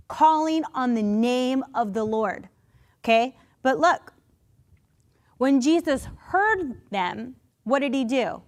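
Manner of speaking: ordinary delivery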